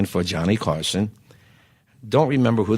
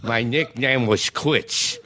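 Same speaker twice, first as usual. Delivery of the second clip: put-on slightly higher slurry punch-drunk boxer, slow on the punchline; punch-drunk fighter voice; 3 semitones higher, slower than usual. Comic telling his partner his nickname as a punch-drunk fighter. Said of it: "I'm talking like this".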